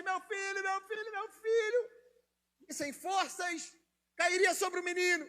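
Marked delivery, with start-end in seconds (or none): 0.0-2.0 mild desperate cry. Acted. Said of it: "começaria a gritar desesperadamente".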